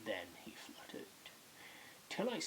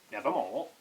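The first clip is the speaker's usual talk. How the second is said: loud, abrupt raven reply; raven's pronouncement; 9 dB louder than usual. A narrator's reading. Raven answering the man's question, loud pronouncement.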